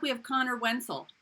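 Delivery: normal talking voice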